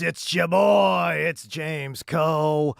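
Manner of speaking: loud, high, drawn-out show opener; hype radio intro. Acted. Host opening his radio show to Los Angeles, pumped up.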